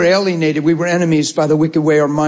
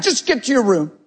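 high, exasperated cut-in; frustrated dad; 8 semitones higher than usual. Acted. Dad cutting off his child's protest, exasperated. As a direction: act out a parent overruling a protest. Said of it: "correct them in frustration".

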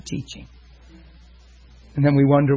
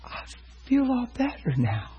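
put-on high, soft voice of a small boy; quiet, child's relief; soft little boy voice; 9 semitones higher, 7 dB quieter than usual. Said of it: "real soft voice".

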